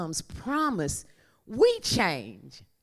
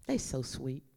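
normal talking voice

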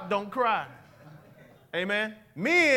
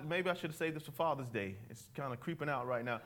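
sheepish aside; 8 semitones lower, 11 dB quieter, faster than usual. Real, not acted. Sheepish aside mid-sermon.

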